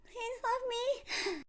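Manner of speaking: tiny high-pitched plea; slow, high, pitiful imitation; mock begging. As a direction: beg in a small, squeaky mock voice. Mimicking woman's begging.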